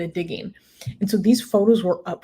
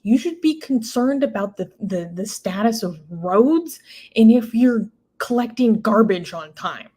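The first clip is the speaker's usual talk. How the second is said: usual speaking voice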